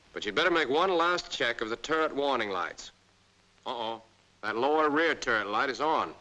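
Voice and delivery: ordinary talk, nothing marked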